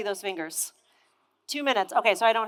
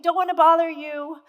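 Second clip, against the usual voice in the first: high-pitched and sweet; 7 semitones higher, 4 dB louder than usual. Retold or imitated. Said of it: "I was really high-pitched and sweet".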